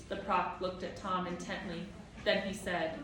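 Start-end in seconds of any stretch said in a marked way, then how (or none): none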